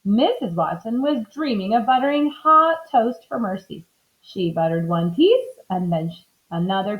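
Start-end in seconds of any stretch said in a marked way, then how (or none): none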